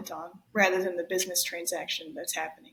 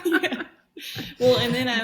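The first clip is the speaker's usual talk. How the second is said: laughing; 4 semitones higher, 6 dB louder than usual. Real, not acted. Laughing along.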